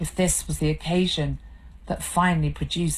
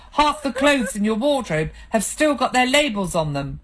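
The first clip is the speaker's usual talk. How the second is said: angry tirade; 5 semitones higher than usual. Acted. Husband berating his crying wife, angry and bitter. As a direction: lay into her, bitter and angry.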